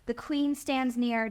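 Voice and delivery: ordinary delivery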